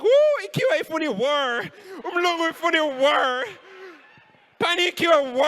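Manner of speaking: high, loud mocking cousin voice, laughing; mock him shrilly in Zulu-accented English. Cousin mocking his pronunciation.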